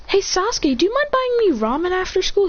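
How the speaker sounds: put-on high-pitched girlish request; Sakura. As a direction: ask sweetly in a high girl's voice. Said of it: "Then Sakura says".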